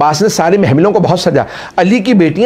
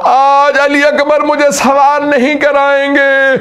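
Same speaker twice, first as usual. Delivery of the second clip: high, slow, tearful wail; weeping; 10 semitones higher, slower than usual. Acted. Preacher voicing Zainab's refusal, weeping. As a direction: let the sob break through the words.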